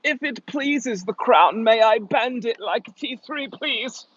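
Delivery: hammed-up British courtier pleading to the crown; posh courtly British. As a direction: plead like a foppish English courtier, slow and high. Mock courtly British plea.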